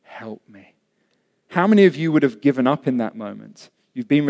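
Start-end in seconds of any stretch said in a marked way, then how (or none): 0.0-0.8 low, hushed plea, fading away; kneeling plea. Acted. Woman kneels before Jesus for help, hushed low plea. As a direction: beg softly, pausing before the last words. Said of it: "she knelt before him, saying".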